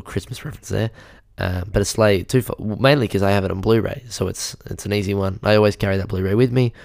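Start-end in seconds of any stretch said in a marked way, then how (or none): none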